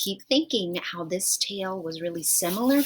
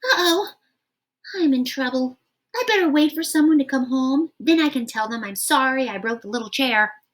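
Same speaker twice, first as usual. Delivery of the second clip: worried Goldilocks; 5 semitones higher, 5 dB louder, faster than usual. Acted. Goldilocks worried after breaking chair.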